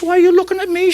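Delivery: loud, high indignant wife; Irish wife. Acted. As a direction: protest as a comic Irish widow. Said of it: "they're looking at the Irish lady".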